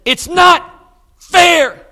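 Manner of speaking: high, loud, anguished protest; pained high wail; upset cry. Retold or imitated. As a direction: cry out that it isn't fair, near tears. Young mother in hospital, upset.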